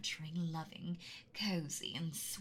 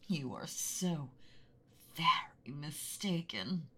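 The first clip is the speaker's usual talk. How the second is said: menacing, hushed dragon threat, drawn out; mean angry dragon voice. Acted. Dragon to a trespassing boy, menacing hush. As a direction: loom over him like a beast in its lair. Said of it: "mean, angry dragon voice".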